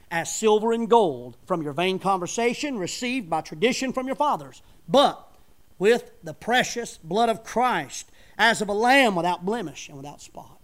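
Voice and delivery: booming King James reading; scripture preaching. Acted. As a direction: proclaim the verse to the congregation.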